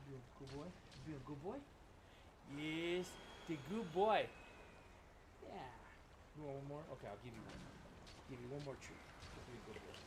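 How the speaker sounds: low, very soft talk to a pet; doting dog talk. Real, not acted. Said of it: "if I talked to Kit like that".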